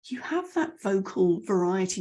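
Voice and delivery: normal talking voice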